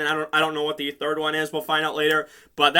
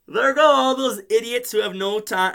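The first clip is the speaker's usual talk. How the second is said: ordinary talk, nothing marked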